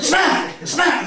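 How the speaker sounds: high-pitched repeated mock slap cries; smacking for effect. Mum spanking her kid, high exaggerated sound-effect cries. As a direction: punch out each word high, like a slap. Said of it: "I'm doing that for effect".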